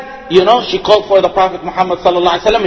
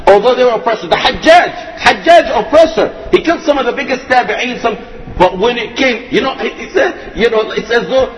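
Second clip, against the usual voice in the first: normal talking voice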